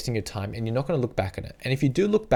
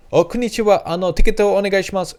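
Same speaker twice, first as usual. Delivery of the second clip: eager foreigner; 8 semitones higher, 7 dB louder, faster than usual. Pretend. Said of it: "they said".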